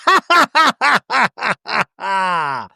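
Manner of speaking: high, loud staccato mock laugh; imitated forced laugh. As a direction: bark out separate high ha's, tapering off.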